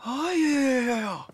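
long, high theatrical swoon; hammed-up swooning sigh. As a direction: overact a swoon of delight. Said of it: "你这戏过了啊".

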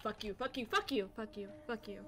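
low-volume, repeated swearing; subdued irritated muttering; muttered cursing. Real, not acted. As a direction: curse softly under your breath. Cursing after being caught.

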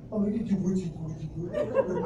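put-on baby talk. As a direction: coo at the dogs like a baby. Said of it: "I turn into a baby".

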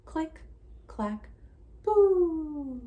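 loud high BOO at the end; spooky boo. Shown as a demonstration. Teacher leading a child in the title, loud boo. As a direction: model the title for the child to echo. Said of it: "Can you try that title with me?".

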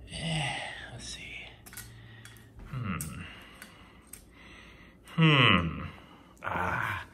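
hushed strained whisper. Real, not acted. Whispering to himself while opening the box.

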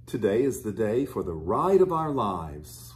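plain reading voice

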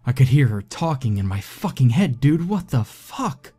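high, strained terrified voice. A narrator's reading. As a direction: spill it out in panic. Terrified friend recounts horror.

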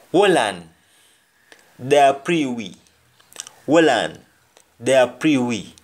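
teacher modelling Jamaican patois. Shown as a demonstration. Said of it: "Hold on, they are watching us".

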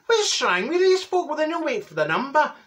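very high, indignant wail; hammed-up, high-pitched exasperation; exasperated complaint. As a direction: despair of the impatient players. Exasperated at players dabbing early.

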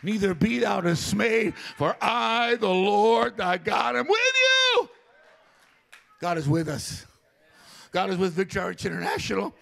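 shouted sermon opener; preaching at full force. Acted. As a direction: declare it to the whole church. Pastor opens sermon declaring God's presence.

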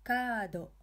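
KA in "Kaado" held long; extended "aa" in Kaado; long vowel. Shown as a demonstration. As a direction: stretch the "Kaa" of Kaado before a short "do". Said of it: "we are extending the ka syllable".

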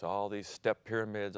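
ordinary talk, nothing marked

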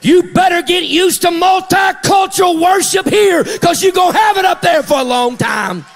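high, loud shouted list, punching each item; full-force preaching. Real, not acted. Preacher tells the church about racism in God's kingdom, full-force preaching. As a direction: drive it out in a rising preacher's shout.

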